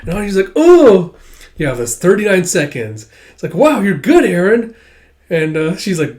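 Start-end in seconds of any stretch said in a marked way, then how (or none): 0.5-1.1 high, loud ooh; amazed child. Retold or imitated. Child watching the obstacle course, amazed. 3.5-4.7 high, loud, excited praise; impressed child. Retold or imitated. Child praising the dog's run, impressed.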